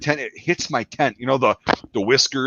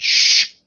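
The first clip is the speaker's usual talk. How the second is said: loud, sharp shush; hissed "shh", all breath; 5 dB louder than usual. Retold or imitated. Soldier retelling how he hushed them, sharp shush. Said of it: "when I said".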